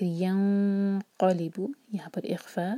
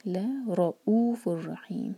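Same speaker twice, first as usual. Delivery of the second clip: slow with रा pressed; stress on रा; slower than usual. Shown as a demonstration. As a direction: press the रा in रऊफुर. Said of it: "रा पर जोर".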